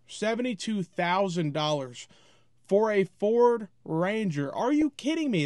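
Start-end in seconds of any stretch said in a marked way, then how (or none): 4.5-5.4 incredulous. Real, not acted. Outraged at truck price.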